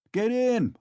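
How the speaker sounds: high, loud shout; shouting again. Acted. Mikolka shouting down the mocking crowd, high and loud. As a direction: yell it over their laughter. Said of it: "Mikolka shouted again".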